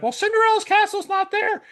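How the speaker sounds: loud, high cartoon falsetto; Mickey Mouse. Virtual Mickey correcting a park fan, high squeaky falsetto. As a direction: pipe up as a know-it-all cartoon mouse. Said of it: "He was pretending to be virtual Mickey".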